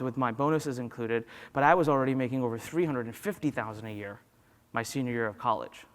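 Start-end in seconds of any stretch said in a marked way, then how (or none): none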